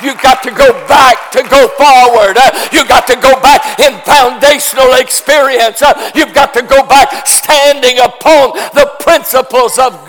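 impassioned pulpit shout; full-force preaching. Real, not acted. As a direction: preach it in rising waves, landing hard on the last words.

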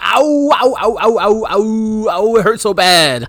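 high, overdone cries of pain; hammed-up pain. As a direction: wail it like a big oversell. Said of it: "he sells it so big".

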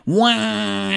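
high, drawn-out cartoon "wah"; silly cartoon Wario "wah". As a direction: stretch "wah" long and high. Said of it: "his voice has over time become".

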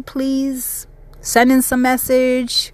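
ordinary talk, nothing marked

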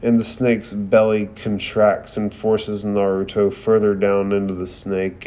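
ordinary delivery

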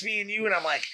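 ordinary talk, nothing marked

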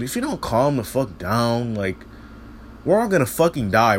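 normal talking voice

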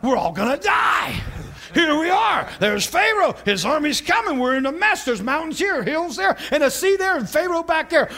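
wailing mock panic, pitch jumping up; acted panic. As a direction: cry out in hammed-up dread. Voicing the panicking Israelites at the sea.

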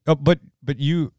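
normal talking voice

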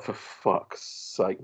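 very slow, breathy whispered curse; dismayed whisper. Retold or imitated. Dad seeing his daughter's wonky haircut, whispered curse. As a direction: breathe it out, stunned and appalled.

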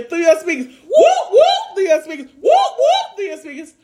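high hype whoop, whoop. Real, not acted. Hype call to listeners.

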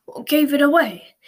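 a hint of a grunting old wizard; grunted. A narrator's reading. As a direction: grunt it as he staggers up. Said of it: "he grunted".

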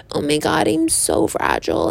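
lightly voiced fragile teenage girl; mock fragile Bella. Imitating Bella from Twilight, a hint of fragile girl. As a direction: play Bella as fragile and helpless. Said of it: "why Bella acts so, like".

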